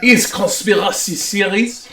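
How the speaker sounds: comic French lecturer; mock-French host announcing his topic; hammy French accent. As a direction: present it in a thick fake French accent. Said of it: "you doing this accent".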